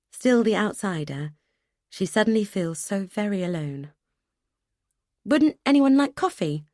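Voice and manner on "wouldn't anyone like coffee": anxious hostess. A narrator's reading. Hostess pleading, higher.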